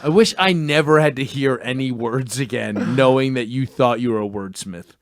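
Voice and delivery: low, controlled delivery; deadpan dismay. Real, not acted. Host restating his complaint to friend, deadpan dismay. Same line restated after outburst.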